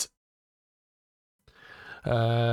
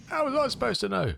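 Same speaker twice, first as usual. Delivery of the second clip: much higher squeaky retort in character; mock reply; 14 semitones higher than usual. Streamer answering his own film quote, much higher.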